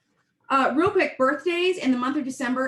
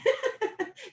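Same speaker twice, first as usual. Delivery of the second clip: amused laugh; 14 semitones higher than usual. Real, not acted. Laughs at better wording.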